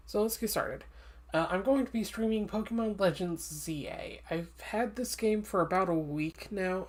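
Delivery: ordinary delivery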